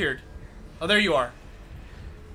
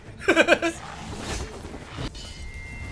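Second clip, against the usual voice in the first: laugh; 8 semitones higher, 6 dB quieter than usual. Real, not acted. Laughing at the boss fight.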